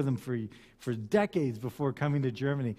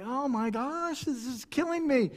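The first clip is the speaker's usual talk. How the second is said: high-pitched exasperated groaning aside; mock agony; 8 semitones higher than usual. Reluctant bargainer who hates conflict, mock-agonised. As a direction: suffer comically, pitched up. Acts own agony at haggling.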